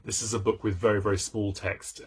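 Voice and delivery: ordinary delivery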